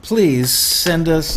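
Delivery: high, loud, slow congratulations; hyped giveaway announcement. Real, not acted. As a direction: cheer the winner on like a host. Announcing the book giveaway winner.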